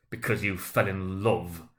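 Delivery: slow, sneering growl; mock his reason with contempt. A narrator's reading. Scrooge sneering at his nephew's marriage, slow growl. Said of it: "growled Scrooge".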